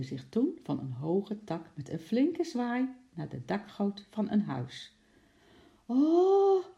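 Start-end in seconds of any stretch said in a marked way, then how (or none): 5.9-6.6 high, loud drawn-out "oh"; crowd cry. A narrator's reading. People call out as they watch the escape, loud high cry. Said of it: "riepen de mensen".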